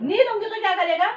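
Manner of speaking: angry shout. Acted. Mother shouting refusal at son.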